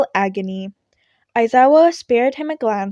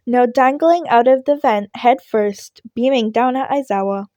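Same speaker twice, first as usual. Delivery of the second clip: plain reading voice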